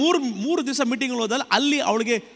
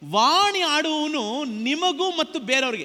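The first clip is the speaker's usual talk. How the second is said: scripture reading; 7 semitones higher, 3 dB louder, slower than usual. Real, not acted. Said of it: "reading scripture aloud".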